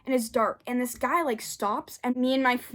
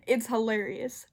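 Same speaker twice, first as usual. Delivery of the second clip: ordinary delivery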